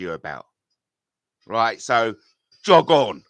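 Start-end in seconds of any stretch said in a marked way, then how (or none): 2.6-3.2 loud, scornful brush-off; loud send-off to the doubters; dismissive shout. Real, not acted. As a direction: bark him away. Dismissing critics.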